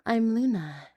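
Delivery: usual speaking voice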